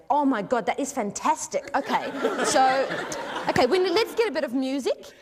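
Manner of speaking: mock-thrilled director taking charge; gushing director. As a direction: gush with delight, half laughing. Imitates director's delight at improvisation.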